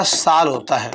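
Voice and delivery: साल with a long aa; long vowel. Shown as a demonstration. Teacher gives the correct form, a long-vowel साल. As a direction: stretch the aa in साल.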